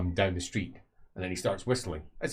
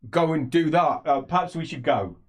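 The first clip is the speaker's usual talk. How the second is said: slightly louder, pointed; 6 semitones higher, 6 dB louder than usual. Acted. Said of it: "he says slightly louder".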